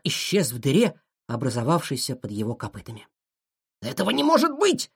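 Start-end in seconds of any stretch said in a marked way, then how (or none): 3.8-4.9 high-pitched doggy bark of disbelief; barked exclamation. A narrator's reading. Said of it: "пролаял пёс". (пролаять высоким голосом)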